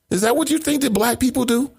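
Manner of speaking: pitched high in outraged disbelief; incredulous. Real, not acted. Host challenging an author in outraged disbelief. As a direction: ask it like you can't believe it.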